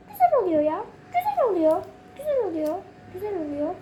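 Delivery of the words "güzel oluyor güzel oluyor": normal talking voice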